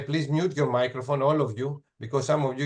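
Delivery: usual speaking voice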